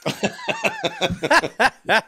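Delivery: real laughter breaking into a high laugh. Real, not acted. Telling the host about the coffee spill, laughing for real. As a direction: giggle through the coffee mishap.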